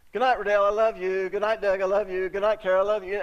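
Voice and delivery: put-on calling goodnight to each child; cheerful goodnights. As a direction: call out each goodnight fondly. Family bedtime goodnights retold.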